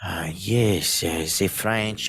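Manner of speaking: a hint of a haughty French voice; lightly put-on French snob; mock French accent. Joking with friends in a light mock French voice. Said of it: "I'm not very good at French accents".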